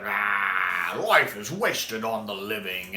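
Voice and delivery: slow, lightly hammed-up villain line; hammy Master. Fan lightly hamming up the Master's final lunge line. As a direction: ham it up a bit, slowly. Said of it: "hammy at the end".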